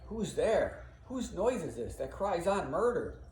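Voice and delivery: feigned ignorance. Acted. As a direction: act as if clueless. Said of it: "Like he doesn't know".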